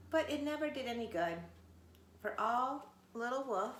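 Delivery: plain reading voice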